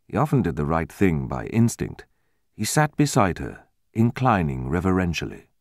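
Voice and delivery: usual speaking voice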